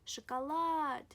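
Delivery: teacher's exaggerated shakaLAAAD for "шоколад"; stress on final A. Shown as a demonstration. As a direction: stretch and punch "лад" in "шоколад". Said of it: "the stress falls on A".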